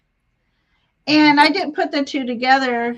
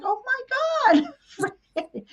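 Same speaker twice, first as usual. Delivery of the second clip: emotional awe; 12 semitones higher, much slower than usual. Retold or imitated. Hearing her fairy name while driving, high, slow and overcome. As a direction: let the name catch in your throat with joy. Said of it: "and I got very emotional".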